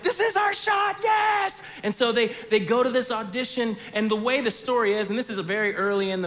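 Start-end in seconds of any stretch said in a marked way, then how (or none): 0.0-1.6 high, loud shout of joy; excited cheer. Retold or imitated. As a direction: yell it like you just got the call. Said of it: "they're like".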